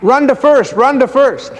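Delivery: high, excited cheering call; crowd yelling. Retold or imitated. Crowd cheering Shia toward first base, high excited yell. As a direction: urge him on to first base. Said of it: "Everyone started yelling".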